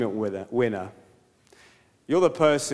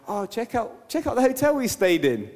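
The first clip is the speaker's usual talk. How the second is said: show-off excitement; 7 semitones higher than usual. Retold or imitated. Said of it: "You're like".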